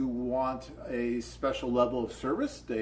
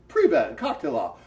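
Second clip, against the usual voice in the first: loud, higher-pitched hello in Russian; acted Russian greeting; 11 semitones higher, 7 dB louder than usual. Man greeting women at a table in Russian, loud and high. Acts out walking up greeting them.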